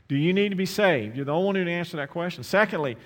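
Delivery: loud, high, urgent altar call; fiery altar call; preaching at full force. Acted. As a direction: call them forward with full voice.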